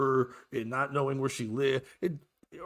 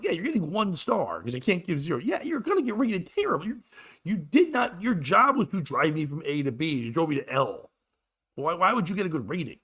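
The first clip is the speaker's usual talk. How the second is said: higher-pitched, worked-up rant; indignant telling-off; 6 semitones higher, 4 dB louder than usual. Retold or imitated. Passenger telling driver about his bad rating, indignant. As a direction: scold with mounting exasperation.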